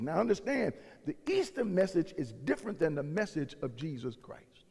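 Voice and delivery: ordinary talk, nothing marked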